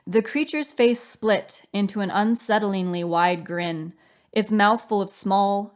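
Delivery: plain reading voice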